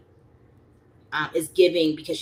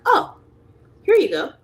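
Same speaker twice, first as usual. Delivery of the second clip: breezy offer; 10 semitones higher, 6 dB louder than usual. Retold or imitated. Handing family money casually.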